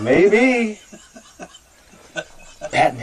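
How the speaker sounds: laughing high and loud; laughing Maybe, amused at the new word. Real, not acted.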